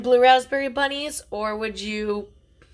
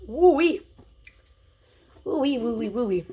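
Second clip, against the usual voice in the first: sour candy reaction; 2 semitones higher than usual. Real, not acted.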